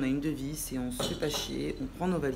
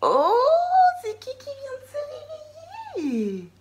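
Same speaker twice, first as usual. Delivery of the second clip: put-on baby talk; 5 dB louder, slower than usual. Mother greets waking toddler.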